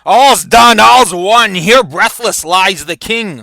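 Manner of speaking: acted warrior's triumphant announcement; triumphant battlefield proclamation. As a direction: proclaim your kill across the battlefield. Douglas announcing the supposed kill.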